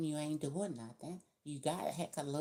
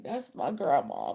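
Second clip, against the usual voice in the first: mock proud claim; 9 dB louder than usual. Voicing a proud adopted young person.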